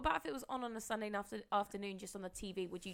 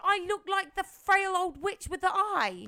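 indignant disbelief; 8 semitones higher, 8 dB louder, slower than usual. Real, not acted. Indignant at witch comparison.

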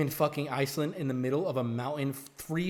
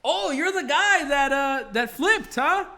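higher, louder, slow teasing instructor; slow, higher mock voice of the instructor; 8 semitones higher, 8 dB louder, slower than usual. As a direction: stretch it out like a grinning guide. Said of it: "and he goes".

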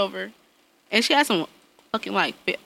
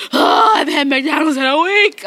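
loud fake sobbing complaint; mock crying whine; 6 semitones higher, 6 dB louder than usual. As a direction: wail it like a dramatic child.

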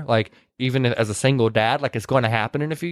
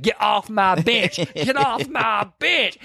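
slow, stretched, higher-pitched protest; mock indignation; 6 semitones higher, much slower than usual. Retelling her pushing the other off, drawn-out mock outrage. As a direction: draw out each word, climbing higher. Acted shove in retold quarrel.

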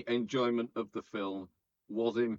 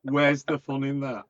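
loud, higher comic objection; mock protest; 4 semitones higher, 8 dB louder than usual. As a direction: protest loudly in mock disbelief. Mock protest, then slower repeat.

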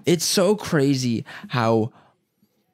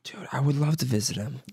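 whisper; 7 dB quieter than usual. Real, not acted. Whispered aside about visiting friend.

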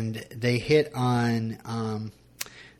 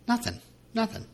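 high, softer mock-wounded voice; small pitched-up protest; mock-hurt complaint; 11 semitones higher, 5 dB quieter than usual. Real, not acted. Husband gets no praise for his story voices, mock-hurt complaint.

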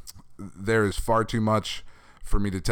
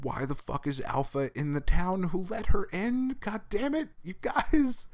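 climbing into a high-pitched, incredulous whine; mock exasperation; 11 semitones higher than usual. Fan scolding comic characters for letting the villain in, mock exasperation. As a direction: voice rising higher and higher in hammed-up dismay.

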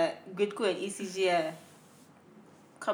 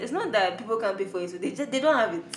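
usual speaking voice

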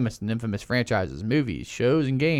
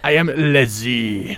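put-on French accent; 4 dB louder, much slower than usual. Intro in fake French accent.

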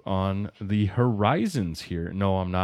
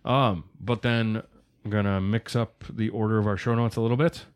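ordinary delivery

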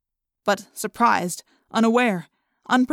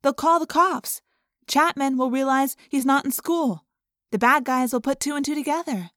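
higher, urgent objection; arguing; 5 semitones higher than usual. A narrator's reading. Cassie arguing against holding Jake, urgent. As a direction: push back fast and worried. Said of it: "Cassie argued".

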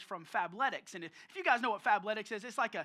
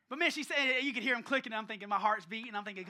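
usual speaking voice

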